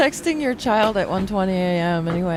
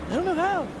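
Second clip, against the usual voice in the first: much higher, helpless mock whine; lightly voiced dad who can't work his phone; 11 semitones higher, 3 dB quieter, faster than usual. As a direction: play the clueless parent, pitched up. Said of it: "I'm like".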